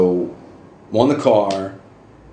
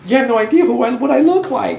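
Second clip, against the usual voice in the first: high, louder amazed protest; incredulous retort; 3 dB louder than usual. Retold or imitated. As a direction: act stunned by the flirting. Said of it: "I'm like".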